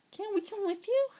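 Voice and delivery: put-on high, pleading young bears. Bears ask Roy to take them home, high pleading.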